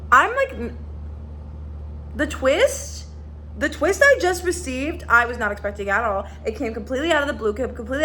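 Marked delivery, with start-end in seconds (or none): 0.1-5.0 excited. Real, not acted. Telling viewers about the twist, high and excited. Reacting to a shocking book twist.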